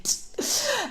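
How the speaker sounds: short high-pitched laugh; little high giggle. Real, not acted. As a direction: break into a quick high laugh. Laughs at her busy period.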